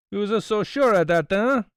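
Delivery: high, loud, leering taunt. A narrator's reading. Italian leering at Poirot while holding the gun, high and loud. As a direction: sneer the challenge at him. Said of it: "leering unpleasantly".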